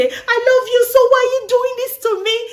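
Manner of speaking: high, loud acted lover's protest; angry outburst. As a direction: plead and yell as if mid-quarrel. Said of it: "talking when we're angry".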